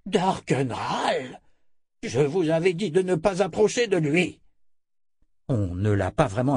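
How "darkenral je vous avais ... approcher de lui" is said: high-pitched, alarmed scolding; reproche alarmé. A narrator's reading. Z s'écrie, leur reproche leur imprudence. (remontrance alarmée, voix aiguë)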